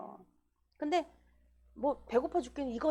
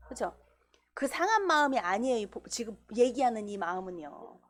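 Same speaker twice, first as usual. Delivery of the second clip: ordinary delivery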